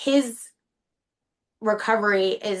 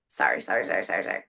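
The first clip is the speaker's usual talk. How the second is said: choked apology; 4 dB quieter, much faster than usual. Real, not acted. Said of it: "You don't need to apologize".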